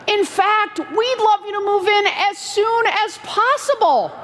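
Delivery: high, enthusiastic invitation; animated good-news delivery; retold glad welcome.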